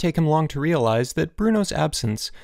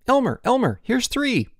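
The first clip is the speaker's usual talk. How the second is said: high-pitched eager call; excited calling; 13 semitones higher, 3 dB louder than usual. A narrator's reading. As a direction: call out a find urgently. Boys call out ant finds.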